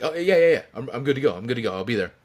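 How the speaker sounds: quick high burst of yeahs, then settling; feigned readiness. Retold or imitated. Wrestler covering his surprise to the promoter, mock-eager agreement. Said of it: "he didn't let on to it".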